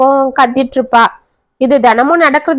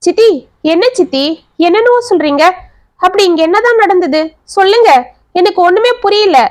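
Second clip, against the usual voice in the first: higher and rising, increasingly upset questions; agitated pleading; 7 semitones higher than usual. Acted. Son pleads with his aunt to explain, agitated and higher. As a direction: push harder with each line, confused and upset. Son desperately asks what happened.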